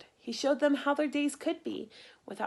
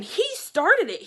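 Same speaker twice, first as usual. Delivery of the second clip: put-on louder, higher childish blame; accusing cry; 8 semitones higher, 8 dB louder than usual. King blaming the dragon, loud accusing cry. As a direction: point the finger and cry out. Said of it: "he cried".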